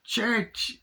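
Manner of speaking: high imitated kid's call; child calling cat. Little boy calling his cat Church, high mock child voice.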